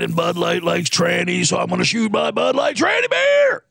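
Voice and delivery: hammed-up sports radio host riff; Scott Farrell impression. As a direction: bellow it like a rambling talk radio host. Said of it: "Scott Farrell".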